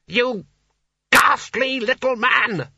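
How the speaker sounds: high, loud, drawn-out fury; furious insult. A narrator's reading. Sconner berating the small wizard, furious. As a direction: spit out the insult at full temper.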